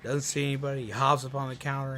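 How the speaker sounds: ordinary talk, nothing marked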